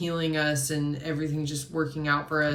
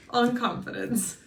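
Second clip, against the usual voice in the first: laughing; 7 semitones higher than usual. Real, not acted. Laughing at her own stumbles.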